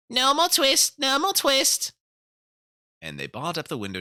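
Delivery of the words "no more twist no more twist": high, loud twittering mouse shout; shouting mice. A narrator's reading. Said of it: "shout at once in little twittering voices".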